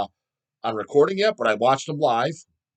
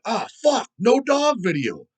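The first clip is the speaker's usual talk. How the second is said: higher, slowed "no dog video" like a let-down fan; mock bummed fan; 9 semitones higher, 4 dB louder, slower than usual. Fan finding no video on day one, mock let-down. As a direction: ham up a disappointed viewer. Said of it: "How many are you gonna be bummed? Like".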